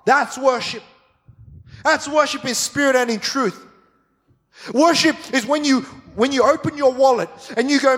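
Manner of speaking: high, ringing sermon voice building; fired-up preaching. Real, not acted. Pastor firing up the church, full force. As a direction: preach it to the back row.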